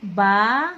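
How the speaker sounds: बा said with a long open आ. Shown as a demonstration. Teacher isolating the syllable बा. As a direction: hold the आ in बा. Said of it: "पहले आप ध्यान देंगे क्या मैंने बोला".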